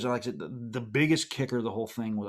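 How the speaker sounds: ordinary talk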